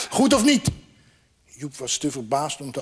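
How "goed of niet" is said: high, excited question; pitched-up, pleased challenge; triumphant prompting. Acted. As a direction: crow it as a proud question. Bird shows off new wings.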